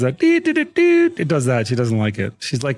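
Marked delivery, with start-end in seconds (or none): 0.0-1.0 high sung dee dee; high chime imitation; imitated chime. Shown as a demonstration. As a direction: sing the phone chime high. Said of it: "it does the follower noise".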